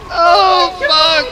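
high, loud cry of dismay; loud, high-pitched curse; alarmed yell. Real, not acted. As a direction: shout out in sudden dismay. Sudden mishap in the game.

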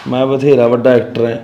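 ordinary talk

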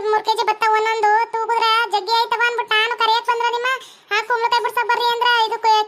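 high, scolding farm boss; cross farm boss. Acted. Farm boss scolding pumpkin pickers for dawdling, cross. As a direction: scold the workers in a put-on high voice.

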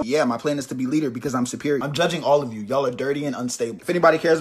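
normal talking voice

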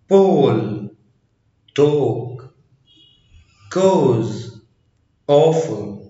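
"بول", "توك", "كوز" with a long O; A pronounced O. Shown as a demonstration. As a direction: say awful starting on a round O. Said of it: "مرة تانية".

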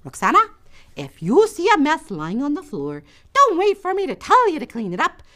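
lightly voiced mother giving a lesson; mom's instruction. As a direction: lay down the rule like a mom. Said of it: "My mom used to always say".

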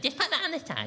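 put-on high, sugary instruction to a child; sing-song nursery voice for housemates. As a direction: coax it out like a nursery teacher. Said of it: "Why are you talking like that".

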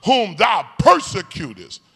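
high, loud proclamation; Jesus answering. Retold or imitated. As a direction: declare it from above. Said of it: "Jesus answered".

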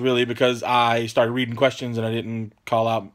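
usual speaking voice

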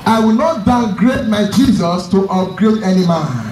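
ordinary talk, nothing marked

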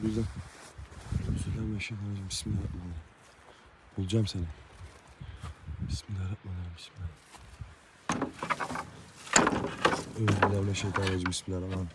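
very soft, rapid murmured prayer. Real, not acted. Alone in the dark, murmured prayers against spirits. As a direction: barely voice the prayers to yourself.

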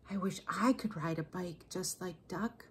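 small, soft mouse voice; wistful mouse. A narrator's reading. Mouse secretly longing to ride like Duck, wistful mouse. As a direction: wish it quietly as a tiny mouse. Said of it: "what he thought was".

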